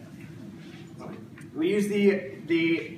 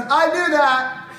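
much higher, louder, slow mock smugness; smug know-it-all; 10 semitones higher, 9 dB louder, slower than usual. Peter mockingly replies to Jesus, hammed-up smug. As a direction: toss it off like a cocky student. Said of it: "and Peter goes".

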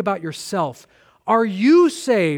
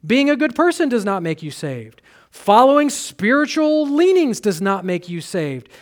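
ordinary talk

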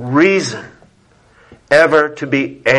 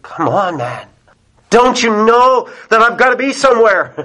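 mock exasperation, rising to a higher-pitched plea; frustrated driver; 8 semitones higher than usual. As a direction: act out impatience with a dawdling pedestrian. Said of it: "The frustration's coming".

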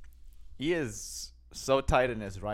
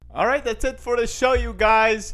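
loud, raised-pitch hype sign-off; podcast outro announcer; 7 semitones higher, 10 dB louder than usual. Real, not acted. Host telling listeners to subscribe and follow, loud announcer voice. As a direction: belt out the plugs like a big show closer.

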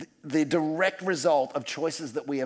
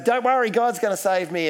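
cheery refusal; 7 semitones higher, 4 dB louder than usual. Retold or imitated. Drowning man refusing again.

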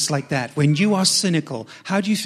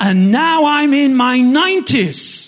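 higher, louder mock exasperation; cynical disbelief; 6 semitones higher, 6 dB louder than usual. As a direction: act out a worn-out old woman's incredulous complaint. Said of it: "laughing 'cause she's cynical".

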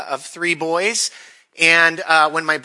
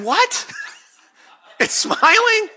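high, laughing disbelief; incredulous; slower than usual. Retold or imitated. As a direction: squeak it out between laughs. Brother hears shark is smiling.

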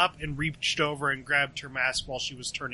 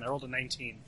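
soft, understated dice result; quietly matter-of-fact; quiet report; 9 dB quieter, much faster than usual. Real, not acted. First take of dice result.

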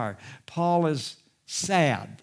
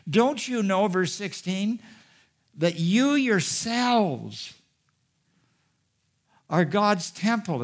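frustrated appeal; 7 semitones higher, 4 dB louder, slower than usual. Retold or imitated. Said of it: "he's frustrated".

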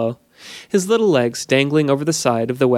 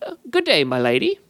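sprite greeting; 6 semitones higher than usual. A narrator's reading.

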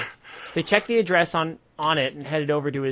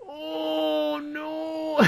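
mock horror; 11 semitones higher, 7 dB quieter than usual. Mock horror at arson pun.

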